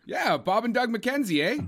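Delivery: put-on high-pitched hoser Canadian accent. Explaining the reference to co-host, hoser Canadian accent. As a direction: lift it into a comic Canadian twang. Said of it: "that's my Canadian accent".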